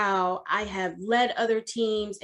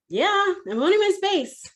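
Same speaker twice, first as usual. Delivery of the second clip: high, sing-out reply to the boss; exaggerated dutiful employee; dutiful answer; 9 semitones higher, 4 dB louder than usual. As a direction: answer high and eager, as if on cue. Her pat answer to boss.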